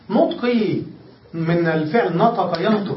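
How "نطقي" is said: usual speaking voice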